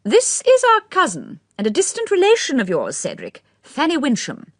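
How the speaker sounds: put-on high, fluttery elderly aristocrat; old lady's voice. A narrator's reading. Lady Mondor introduces.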